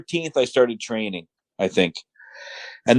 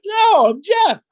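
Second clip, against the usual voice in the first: exasperated correction; 13 semitones higher than usual. Retold or imitated. Exaggerated replay of correcting the Italian.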